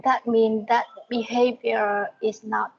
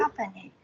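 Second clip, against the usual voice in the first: "Company" softer, stressed on COM; first-syllable stress; 3 semitones lower, 6 dB quieter, faster than usual. Shown as a demonstration. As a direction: repeat "Company" quietly, weight on the first syllable. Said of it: "First stress, okay?".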